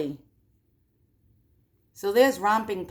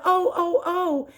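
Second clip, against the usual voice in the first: high mock wails; crying out; 10 semitones higher, slower than usual. Conrad cries as his thumbs are cut, high mock wail. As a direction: wail high like a frightened boy. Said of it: "and Conrad cries out".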